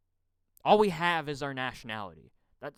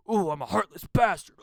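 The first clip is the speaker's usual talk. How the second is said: loud, higher mock villain; mocking self-caricature; 4 semitones higher, 7 dB louder than usual. Denying he's just going off, loud mocking self-caricature. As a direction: blurt it in exaggerated sarcasm. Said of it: "going off on, like".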